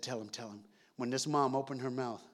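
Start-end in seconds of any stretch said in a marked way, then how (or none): 0.0-0.6 soft, low urging; hushed urging. Retold or imitated. Dad quietly urging mom to tell the boy, hushed. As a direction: press her gently, under the breath.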